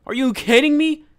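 high, loud disbelieving yell; shocked shout. Real, not acted. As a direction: scream in shock. Shocked after jump scare.

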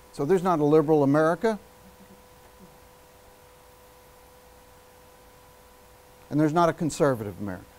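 a long pause in between, speech style; orator's dramatic pause. Shown as a demonstration. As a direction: hold the silence before the second line. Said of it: "Pauses are six seconds long".

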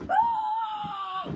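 high loud fighting yell; battle shout. Acted. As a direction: yell as the enemy drops. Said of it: "and go".

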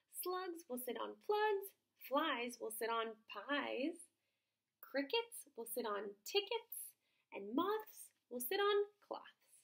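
a pleased frog showing off his rhymes, slowly; proud frog. Acted.

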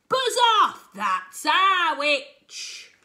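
loud, slow beast shout; monster voice. Acted. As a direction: bellow it like a monster. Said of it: "a yowl and a growl and a croak and a shriek".

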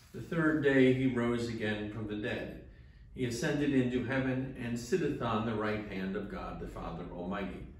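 ordinary delivery

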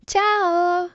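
ordinary delivery, nothing marked

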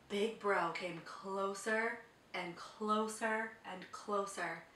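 slow, hushed build; suspense narration. A narrator's reading. Narrator building suspense, slow and hushed. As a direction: draw it out with suspense.

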